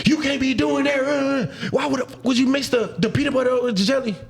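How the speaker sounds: high put-on voice of a meddling critic; nagging shipmate. Shipmate scolding him over his sandwich, high mock nagging.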